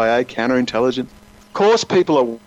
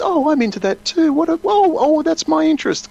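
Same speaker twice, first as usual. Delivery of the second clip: much higher, gushing mock delight; fake enthusiasm. Stalker fakes a shared hobby, hammed-up delight. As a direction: overplay the thrilled new friend. Spy faking shared interests.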